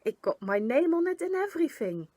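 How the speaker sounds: proud and eager; excited. A narrator's reading. Gunk excited shows postcard.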